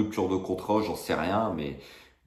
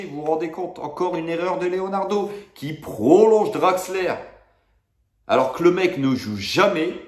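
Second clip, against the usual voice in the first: escalating comedy rant; 9 semitones higher, 4 dB louder than usual. Real, not acted. Fin du coup de gueule.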